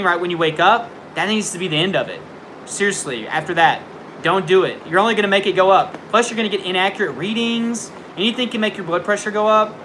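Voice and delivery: usual speaking voice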